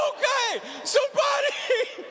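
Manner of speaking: high, slow panicked cry; panicked call for help. Retold or imitated. Husband panicking in hospital hall, high frantic call.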